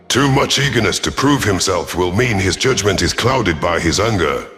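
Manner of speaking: plain reading voice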